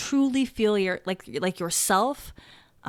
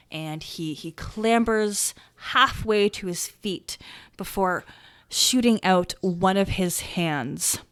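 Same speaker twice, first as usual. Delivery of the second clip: ordinary talk, nothing marked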